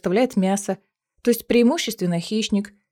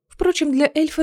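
ordinary delivery, nothing marked

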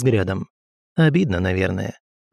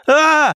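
pained cry; 5 dB louder than usual. A narrator's reading. Ghost cry under pressure.

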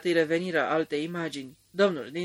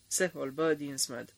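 plain reading voice

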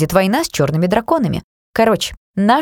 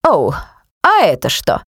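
surprised. Acted. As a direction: pounce on it with curiosity. Dark elf grabs at pocket.

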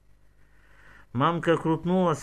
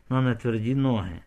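plain reading voice

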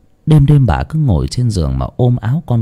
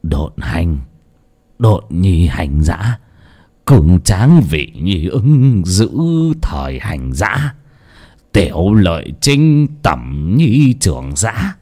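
put-on low, slow, chant-like old oracle; an old seer reciting a grim verdict; weary sigh; 4 semitones lower, much slower than usual. A narrator's reading. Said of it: "thở dài". (giọng trầm, chậm, ngâm như đọc quẻ)

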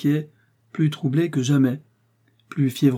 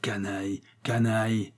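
softer, grumbled under the breath; husband pacing and grumbling about the banker, muttered; 4 dB quieter than usual. Acted. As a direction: grumble the insult to himself. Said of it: "Il ronchonnait".

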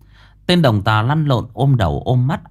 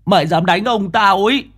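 put-on furious child; 9 semitones higher than usual. A narrator's reading. Boy furious firing slingshot.

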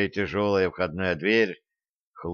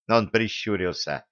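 usual speaking voice

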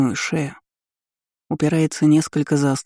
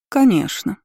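higher, falsely obliging; lightly insidious, pitched-up assent; sly smile; 5 semitones higher than usual. A narrator's reading. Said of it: "коварно улыбалась Элира Ридалейн".

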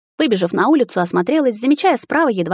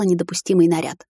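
usual speaking voice

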